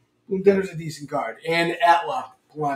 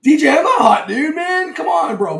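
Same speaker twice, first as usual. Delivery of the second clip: higher and louder, laughing it off; loud protest; 10 semitones higher, 6 dB louder than usual. Real, not acted. Host protesting to chat that he isn't hot, loud and high. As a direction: shout the denial, half-joking.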